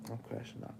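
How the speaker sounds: usual speaking voice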